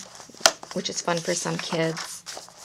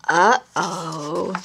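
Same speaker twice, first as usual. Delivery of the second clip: playful uh-oh; 5 dB louder than usual. Shown as a demonstration. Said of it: "saying over and over again".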